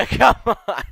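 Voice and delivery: high, loud laugh through the words; laughing protest. Real, not acted. Teasing his friend Ben for picking China, laughing loudly. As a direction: laugh it out in disbelief.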